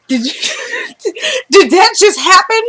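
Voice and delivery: high laugh breaking into the question; disbelieving laugh. Real, not acted. Host asks viewers if it really happened, laughing high. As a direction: laugh helplessly at the shock.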